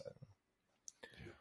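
breathless whisper under the breath; whispered aside. Real, not acted. Co-host giving up on naming the accent, whispered aside. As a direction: let it fall away into a whisper. Trailing off after an accent guess.

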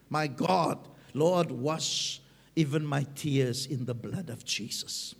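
low, soft plea to God; hushed prayer. Acted. Preacher praying to the Lord about his tears, hushed prayer. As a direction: pray it quietly, pitched well down.

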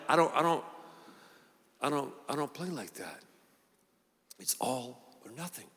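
God answering calmly and firmly, dropping to a near hush; God's firm reply. Retold or imitated. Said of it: "God's like".

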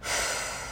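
long exhaled sigh; frustrated sigh. Real, not acted. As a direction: let out a heavy breath. Sighs over a frustrating story.

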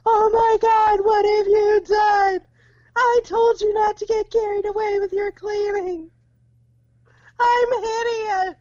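high, loud, wailing despair over ruined hair; mock hysterical wail. Friend retells vain wizard crying over hair, high mock wail. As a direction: shriek it like a vain wizard in tears. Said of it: "clutching it and screaming".

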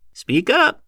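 put-on high, loud old heckler; tinder-dry, pushy horse trader; insistent. A narrator's reading. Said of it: "insisted Hannum".

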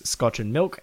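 ordinary talk, nothing marked

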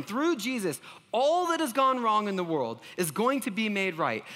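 normal talking voice

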